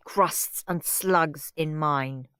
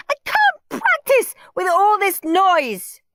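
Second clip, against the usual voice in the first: whiny rant; 13 semitones higher, 7 dB louder, slower than usual. Acted. Margaret ranting after screaming.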